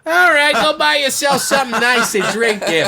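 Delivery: high, loud, laughing mock voice; laughing mock local. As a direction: toss off a laughing brush-off. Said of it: "mocking the doomsayer".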